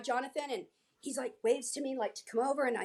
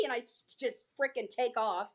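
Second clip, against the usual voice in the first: ordinary talk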